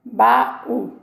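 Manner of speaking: "Baú" in two beats, ba, then ú; "Baú" broken apart as ba-ú; separated syllables. Shown as a demonstration. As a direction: leave a gap inside "Baú" before the ú. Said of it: "eu separo a sílaba".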